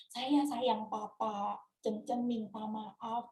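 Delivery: low, slow and soft; tender unspoken apology. Retold or imitated. Daughter voicing words she never told papa, low and tender. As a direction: say it softly and slowly, as words never spoken. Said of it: "saya tuh belum pernah bilang".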